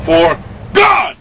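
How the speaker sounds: "For God" shouted loud and high; short shout. Shown as a demonstration. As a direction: punch the words out hard. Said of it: "this is the big test".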